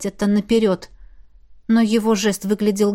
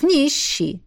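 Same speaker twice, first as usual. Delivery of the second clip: higher, teasing brush-off; mocking; 6 semitones higher than usual. A narrator's reading. Man watching her search, mocking. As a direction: say it with a taunting lilt. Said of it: "с насмешкой ответил Джаф".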